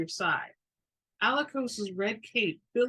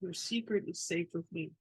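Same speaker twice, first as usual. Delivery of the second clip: quiet and confidential, much softer; low, hushed voice; 3 semitones lower, 8 dB quieter than usual. Acted. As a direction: keep it low between two men. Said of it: "keeping his voice low".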